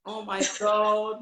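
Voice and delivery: slow, drawn-out, moved; moved to tears by a friend's words; teary. Real, not acted. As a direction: overcome with feeling. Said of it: "you made me teary".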